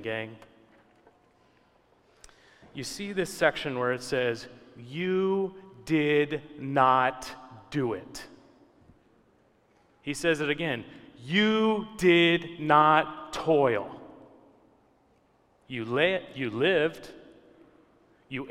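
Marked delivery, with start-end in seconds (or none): 4.8-8.3 drawn-out, word-by-word delivery; slow, deliberate scripture quote; emphatic quote. Acted. Preacher hammers home scripture's point. 11.2-14.0 very slow, each word stretched; drawn-out, deliberate delivery; emphatic quote. Acted. Repeats scripture's point emphatically.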